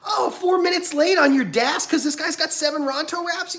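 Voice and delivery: very high, whooping mock-cheerful voice; hammed-up cheery greeter. Upbeat cast member welcoming guests to Disney, exaggerated high cheer. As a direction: gush in a piercingly high theme-park welcome, stretching the sign-offs. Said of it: "People who are like".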